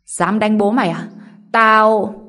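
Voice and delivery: fly off the handle, ending on a yell; furious, rising outburst; crazed rage. A narrator's reading. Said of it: "Lý Hạo Sâm rồ lên".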